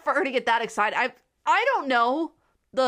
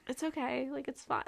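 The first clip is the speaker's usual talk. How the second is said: much softer, hushed forgiving voice; soft reassuring; 2 semitones higher, 11 dB quieter than usual. Retold or imitated. Woman in the movie forgiving him, soft hush. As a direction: murmur it gently as if it's fine. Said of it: "And she's like".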